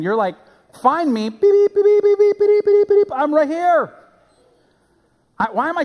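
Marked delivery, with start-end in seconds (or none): 0.8-3.9 high, loud electronic beep-beep; be a lost phone pinging to be found, high and loud; imitated phone beeping. Sinner as a lost phone calling to evil, imitated beeping. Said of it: "That's what you're doing when".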